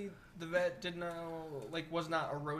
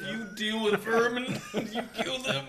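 cracking up as he asks; laughing; 5 semitones higher, 7 dB louder than usual. Real, not acted. Laughing at the vet joke, asking between laughs.

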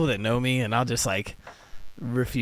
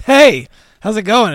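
chipper clerk; 9 semitones higher, 13 dB louder, slower than usual. Retold or imitated. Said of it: "he's like".

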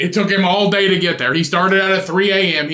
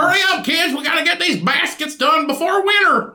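much higher, hurried urging; 10 semitones higher than usual. Retold or imitated. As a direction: push the kids to finish baskets.

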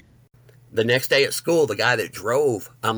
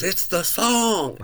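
very slow, much higher, stretched in awe; amazed recognition; 9 semitones higher, much slower than usual. Retold or imitated. Friend hearing the song at last, slow and high in amazement. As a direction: draw it out like a revelation.

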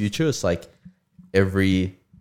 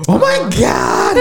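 slow, high, drawn-out shout; overwhelmed excited cry; 12 semitones higher, much slower than usual. Real, not acted. Excited over movie marathon plan.

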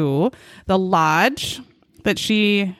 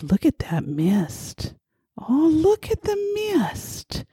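prim wonder; 6 semitones higher, slower than usual. Retold or imitated. Mocking friend's prim wonder.

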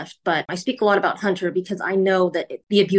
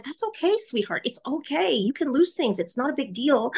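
soft, higher comforting voice; gently calm a scared child; soothing mother; 6 semitones higher, 4 dB quieter than usual. Retold or imitated. Mother reassuring her frightened son about the lost piece, softly and tenderly.

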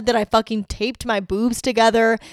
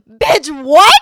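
loud, high disbelieving cry; stunned reaction to an unfair punishment; shocked outrage; 6 semitones higher, 12 dB louder than usual. Real, not acted. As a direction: snap back in total disbelief. Shocked at detention.

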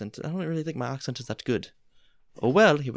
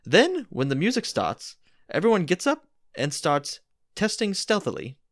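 hammed-up storyteller voice; playful theatrical narration; dramatic story reading; 5 dB louder than usual. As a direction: read it like a grand dinner theater tale. Reads the Mad Lib story aloud.

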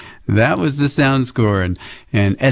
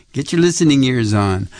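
plain reading voice

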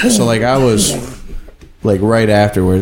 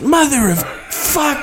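high mock yell of pain. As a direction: yell it like you just kicked a steel beam. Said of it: "And he goes".

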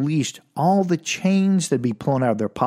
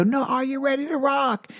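higher, insistent rock frontman call; rock band hype; 11 semitones higher than usual. Retold or imitated. Band demanding a better answer, insistent mock hype.

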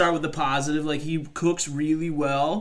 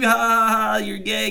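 mocking taunt; 7 semitones higher, 6 dB louder than usual. Mocking prankster voice.

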